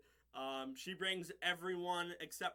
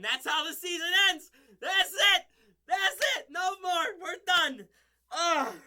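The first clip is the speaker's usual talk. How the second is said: much higher and louder mock-dramatic wail; hammed-up anguish that the season is over; loud finale; 11 semitones higher, 15 dB louder than usual. Real, not acted. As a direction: belt out the ending like it's a tragedy. Dramatic end-of-season announcement.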